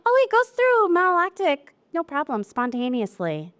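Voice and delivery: mocking a client who shrugs off malolactic, in a breezy voice; imitating a breezy client. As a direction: mimic the client's easy confidence. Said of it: "I hear again and again from my clients".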